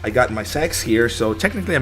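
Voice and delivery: usual speaking voice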